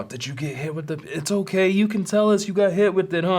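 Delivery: high, eager mock kid voices; pry for the gossip; curious classmates. Classmates pestering the kid, high mock eager. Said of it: "they're all".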